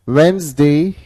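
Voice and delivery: "वेडनेसडे" as WENZ-day, lilting up at the end; silent D. Shown as a demonstration. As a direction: model "वेडनेसडे" for a class, no D before the N. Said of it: "इसमें डी का प्रयोग नहीं होगा".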